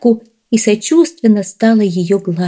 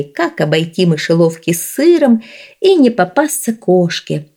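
plain reading voice

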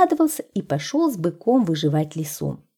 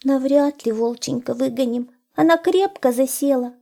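doubtful hare; 6 semitones higher than usual. A narrator's reading.

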